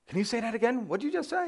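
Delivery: high-pitched mock puzzled listener; baffled Californian. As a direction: ask as if thrown by a strange accent. West Coast listener baffled by accent.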